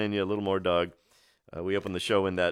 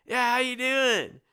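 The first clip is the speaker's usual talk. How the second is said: high, mellow hippie drawl, imitating; laid-back hippie Doug; slower than usual. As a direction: ape Doug's groovy hippie way of talking. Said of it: "He really did talk like that".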